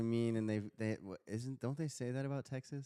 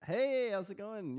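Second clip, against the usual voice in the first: high, slow, chirpy greeting; mock eager newcomer; 11 semitones higher, 4 dB louder, slower than usual. Imitating a new speaker greeting the room, high and slow. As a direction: play the nervous first-timer saying hi.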